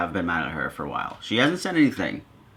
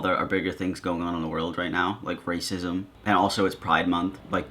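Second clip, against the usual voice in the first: ordinary talk, nothing marked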